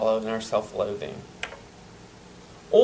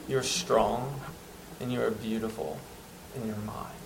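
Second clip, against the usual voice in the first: tender voice of God; 8 dB quieter than usual. Acted. God speaking tenderly to his child, softly. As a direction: gently reassure a beloved child. God's tender words to believer.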